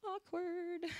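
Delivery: high, soft, drawn-out aside; awkward aside. Real, not acted. Worship leader admitting an irony, high and soft awkward aside. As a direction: pipe it up softly to defuse the moment. Jokes about an awkward confession.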